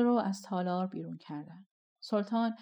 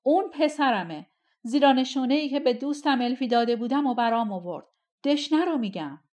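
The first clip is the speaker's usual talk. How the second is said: loud, high, fast declaration; loud proclamation; 4 semitones higher, 7 dB louder than usual. A narrator's reading. Sultan points to the impostor: loud, fast declaration. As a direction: announce it to the whole hall.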